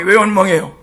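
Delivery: usual speaking voice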